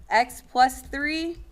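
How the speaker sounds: slow, loud, spelled out while writing; slow dictation. Shown as a demonstration. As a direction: say each word slowly as you write it. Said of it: "I'll show ya".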